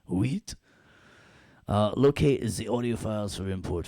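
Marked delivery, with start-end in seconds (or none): 0.1-0.6 a hint of French, Huit said lightly higher; French word. Shown as a demonstration. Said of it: "as my French friends say".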